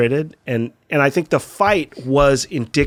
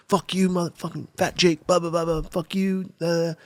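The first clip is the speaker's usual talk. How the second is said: muted, higher-pitched imitation of yelling; mock yell; 4 semitones higher, 5 dB quieter than usual. Angry friend yelling at the filmer, imitated as a muted yell. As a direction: yell it in miniature, without the volume. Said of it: "he's yelling".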